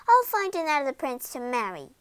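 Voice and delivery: plain reading voice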